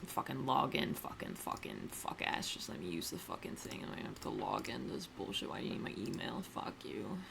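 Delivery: under-the-breath cursing at the login page; muttering. Real, not acted. Annoyed at a login prompt, muttering curses.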